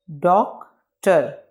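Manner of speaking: Doctor slowly as doc, pause, ter; two separate beats on doctor; broken into syllables. Shown as a demonstration. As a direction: leave a gap inside doctor. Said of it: "इसको pronunciate कर लेते हैं".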